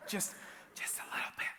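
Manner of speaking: hushed, breathy whisper of a confession; whispered aside. Pretend. Preacher admitting he's overdramatic, in a whisper. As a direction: whisper it like a sheepish secret. Self-mocking aside to congregation.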